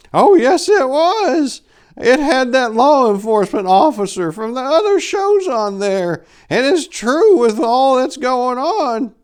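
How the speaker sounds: put-on very high, loud old-lady falsetto; old lady Pickles. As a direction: squeal it out like a frantic old woman. Old lady insists on TV show.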